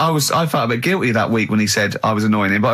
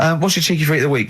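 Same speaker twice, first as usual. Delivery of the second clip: hurried; 3 semitones higher, faster than usual. Real, not acted.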